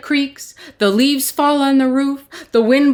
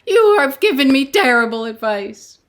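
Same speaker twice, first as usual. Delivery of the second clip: louder, higher, fast angry rant; angry complaint; 7 semitones higher, 5 dB louder than usual. Acted. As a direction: storm in and blame the wise man. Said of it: "Peter was angry now".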